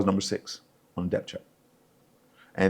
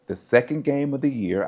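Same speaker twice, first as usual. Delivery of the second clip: ordinary talk, nothing marked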